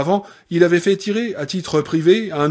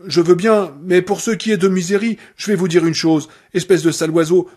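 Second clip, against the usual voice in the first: higher and louder angry nurse; Annie building anger; 5 semitones higher, 4 dB louder than usual. Acted. Annie threatening Paul over Misery, rising anger.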